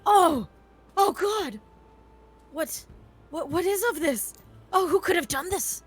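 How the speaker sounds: loud, high, overacted horror; feigned shock. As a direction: lay on the fake dismay thick. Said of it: "your very clear lie".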